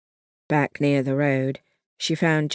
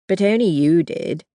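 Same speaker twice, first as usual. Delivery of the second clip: pleased with herself, louder; smug; 3 semitones higher, 5 dB louder than usual. A narrator's reading. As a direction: say it smugly, teasing. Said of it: "she said smugly".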